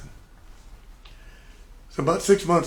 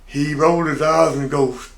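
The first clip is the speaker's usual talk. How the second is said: normal talking voice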